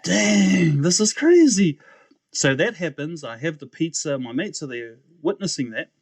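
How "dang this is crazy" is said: high, loud burst of amazement; amazed. Retold or imitated. Young man hearing a record deal, amazed. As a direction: blurt it out, floored by the offer. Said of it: "And I was like".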